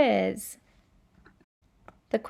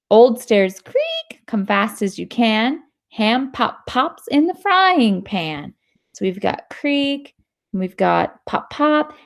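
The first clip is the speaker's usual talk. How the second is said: put-on louder, lively read-aloud voice for kids; reading to children; 8 dB louder than usual. Teacher reading a sound-word book to students, lively. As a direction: bring the story alive for the class.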